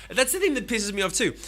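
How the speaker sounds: ordinary talk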